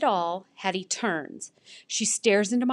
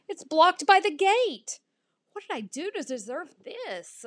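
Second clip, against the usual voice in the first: high-pitched self-pity; dismayed protest; 9 semitones higher, 5 dB louder than usual. A narrator's reading. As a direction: bemoan it like a wronged pup. Dog finds gate, protests.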